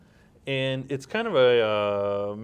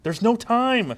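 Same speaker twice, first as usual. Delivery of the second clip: high and loud urgency; soap-opera shout; urgent cry; 11 semitones higher, 7 dB louder than usual. Acted. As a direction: cut him off in a panic. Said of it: "And scene".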